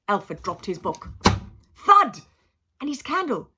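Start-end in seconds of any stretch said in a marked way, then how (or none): 1.8-2.2 sharp shouted thud, higher and louder. A narrator's reading. Narrator acting the dropped book with a loud thud. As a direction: bang out the thud.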